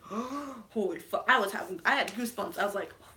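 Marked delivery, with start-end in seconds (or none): 0.0-1.2 gasp, then slow hushed awe; acted breathless shock, drawn out; stunned gasp. Re-enacts stunned gasp in cinema. 3.0-3.1 barely audible, breathed-out shock; re-enacted speechless awe; awed hush. Re-enacts awed reaction with goosebumps.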